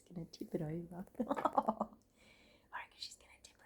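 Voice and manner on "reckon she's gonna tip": hushed whisper so the cats stay undisturbed; barely breathed, no voice at all. Real, not acted. Whispering while watching cats.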